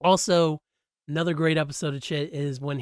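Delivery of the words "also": ordinary delivery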